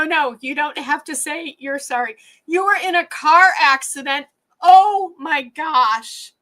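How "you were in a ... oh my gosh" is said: high, loud, real shock; stunned outburst, raised pitch and volume. Real, not acted. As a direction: react to alarming news, drawing out the "oh". Learns friend had car accident.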